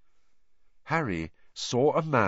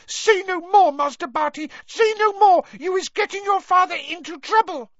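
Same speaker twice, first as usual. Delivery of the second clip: high, loud panicked elf pleading; frantic house-elf; 7 dB louder than usual. Acted. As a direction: beg him to stop, frantic. Frantic house-elf begging master.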